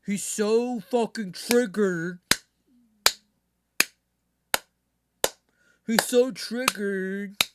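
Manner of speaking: slow, drawn-out mock whine; lightly voiced taunting critic; mocking a whiny critic. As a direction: stretch it out like a sneering impression. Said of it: "this is him".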